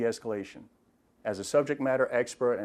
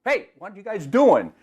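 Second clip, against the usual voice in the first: loud, higher parent's shout across the room; loud verbal command; 9 semitones higher, 9 dB louder than usual. Retold or imitated. Parent calling to squabbling kids, loud shout. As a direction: call out sharply to stop the squabble. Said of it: "some type of loud verbal command".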